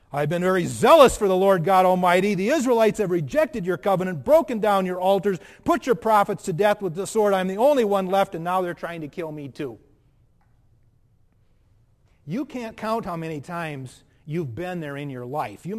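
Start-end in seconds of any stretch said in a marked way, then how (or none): 0.1-9.8 much louder, insistent prophet's lament; Elijah's complaint louder. Acted. Preacher repeats Elijah's answer to God, louder and more insistent. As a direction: hammer out the grievance at full force.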